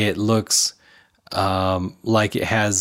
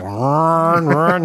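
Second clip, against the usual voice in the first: higher-pitched playful engine revving; imitated motorcycle noise; 5 semitones higher than usual. Actor pretending to ride a motorcycle, making engine noises. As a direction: mimic a motorbike revving with your voice. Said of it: "He's making the noises himself too".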